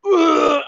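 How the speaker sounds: loud, high vomiting noise; imitated retching. Friend suddenly sick at camp, loud mock retch. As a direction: heave it out like throwing up. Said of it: "starts throwing up".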